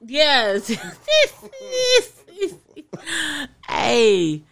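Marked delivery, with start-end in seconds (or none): 0.8-2.0 high laugh. Real, not acted. Friend's joke lands.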